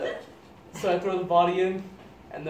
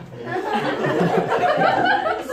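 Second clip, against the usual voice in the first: very high loud laughter; 8 dB louder than usual. Real, not acted. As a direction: shriek with laughter. Laughs at the dilemma line.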